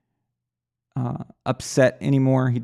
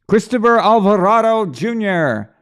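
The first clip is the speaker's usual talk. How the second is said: announcer-style shout-out; 8 semitones higher, 7 dB louder than usual. Pretend. Host welcomes a viewer by name, big hyped shout-out. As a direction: belt the name out like a ring announcer.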